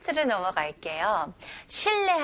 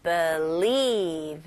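believe with weight on lieve; stress on second syllable; 5 semitones lower than usual. Shown as a demonstration. Teacher drills believe, stress on lieve. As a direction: hit lieve, keep be light. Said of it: "두 번째 음절에 들어가죠".

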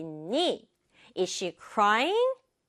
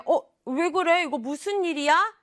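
startled, higher-pitched questioning; concerned alarm; 5 semitones higher than usual. Acted. Asking when something seems wrong, startled concern. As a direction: react as if something bad has happened. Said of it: "안 좋은 일일 것 같을 때는".

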